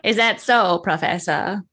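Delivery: slow, teasing vintage purr; Katharine Hepburn voice. Pretend. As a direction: coax him closer, old Hollywood style. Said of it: "Katie from the Philadelphia Story".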